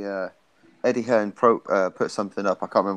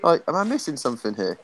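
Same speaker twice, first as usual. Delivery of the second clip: incredulous; 5 semitones higher than usual. Retold or imitated. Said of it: "it's like".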